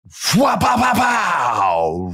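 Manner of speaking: loud, slow "pow pow pow" shouted; drawn-out yelled impact noises; punch sound effects. Retold or imitated. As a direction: shout the hits like comic book sounds. Retelling low blows.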